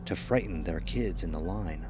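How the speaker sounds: plain reading voice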